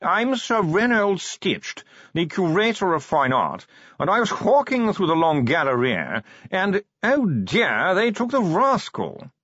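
slow, high, languid aristocratic voice; posh yawning drawl. Acted. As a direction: drawl every word like a yawn. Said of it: "modulated yawning".

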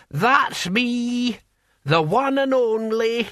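put-on high, slow old man boasting; chuffed old codger, slow; proud old man voice.

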